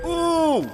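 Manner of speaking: high, loud, long "ooh"; loud whoop. Real, not acted. Streamer cutting someone off on the road, loud excited whoop. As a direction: let out a high, drawn-out whoop.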